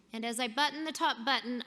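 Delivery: normal talking voice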